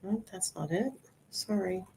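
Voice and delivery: ordinary talk, nothing marked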